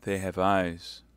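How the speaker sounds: slow, mournful recitation; deep grief. Acted. Milady quoting scripture to Felton, slow grieving tone. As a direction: draw each word out in understated sorrow. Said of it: "with an accent of indescribable grief".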